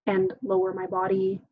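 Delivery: usual speaking voice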